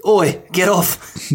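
slow drawn-out oi, get off; a playful slow growl at the cat. Pretend. Listener joins in, slowly shooing the cat.

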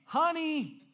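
loud, high, insistent call. Acted. Husband calls his wife who has AirPods in, loud and high. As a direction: raise your voice to get through to them.

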